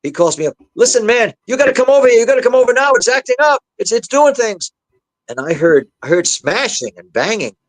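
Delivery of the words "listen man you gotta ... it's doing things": high, loud imitation of a frantic caller; raised, frantic phone-call voice; urgent panicked plea. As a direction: act out an alarmed husband begging for help. Husband's panicked phone call, retold.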